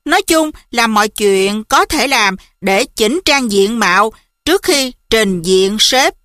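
usual speaking voice

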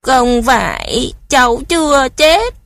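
high, loud, slow tearful wail; crying protest. A narrator's reading. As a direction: protest through tears, voice rising. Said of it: "Cô Tuấn òa lên khóc". (bật khóc phản đối, giọng cao và to)

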